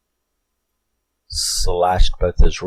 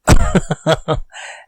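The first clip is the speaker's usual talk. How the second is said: airless, breathy laugh; an unvoiced burst of laughter; 9 semitones higher, 4 dB louder than usual. Real, not acted. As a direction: laugh in disbelief at the line. Laughing at creepy quote.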